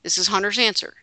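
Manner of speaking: ordinary talk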